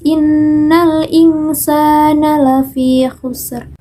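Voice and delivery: slow chant, air flowing through the s of "insana"; free-flowing breath. Shown as a demonstration. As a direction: chant it drawn out, releasing the s in "insana" freely. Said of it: "bisa keluar dengan bebas". ("insana" dilantunkan pelan, udara sin keluar bebas)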